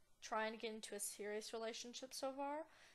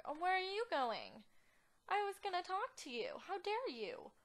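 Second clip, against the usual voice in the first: high-pitched playful outrage; mock indignation; 7 semitones higher, 4 dB louder than usual. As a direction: call after them, mock-offended. Indignant at game character leaving.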